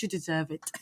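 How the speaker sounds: soft, breathy British AI purr; a lightly faked British accent; bad British accent. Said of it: "I can't do British, uh, accent".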